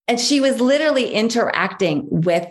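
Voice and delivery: ordinary delivery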